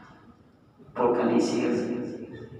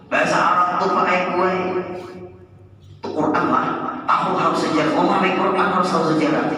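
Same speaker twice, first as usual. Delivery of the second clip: louder sermon voice driving each question; preaching at full force; 6 semitones higher, 6 dB louder than usual. Acted. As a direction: press the congregation with each point. Preacher builds again on Quran history. (berkhotbah lantang, menekan jamaah)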